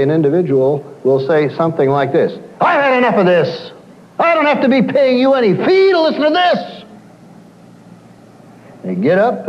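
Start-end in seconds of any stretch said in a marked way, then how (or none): 2.6-6.8 high, mock indignant outburst; indignant client. Counsellor imitates a fed-up client: high mock indignation. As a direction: play the huffy client fed up with paying. Angry client quoted storming out.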